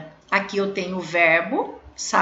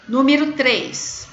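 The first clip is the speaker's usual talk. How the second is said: usual speaking voice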